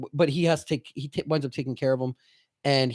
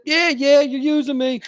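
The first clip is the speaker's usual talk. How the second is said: much higher, louder rote agreeing; Raymond; 11 semitones higher, 6 dB louder than usual. Retold or imitated. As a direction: blurt the yes, pitched way up. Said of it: "And he's like".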